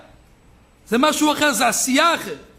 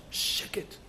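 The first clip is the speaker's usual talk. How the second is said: whispered, drawn-out hush; hushed silence; 4 semitones lower, 12 dB quieter, much slower than usual. Acted. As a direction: breathe it out as a soft hush. Imitates the silence inside the study hall. (לחישה מהסה)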